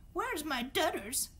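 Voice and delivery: slow hammed-up call for her nephew; Aunt Marge's roar. A narrator's reading. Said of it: "roared Aunt Marge".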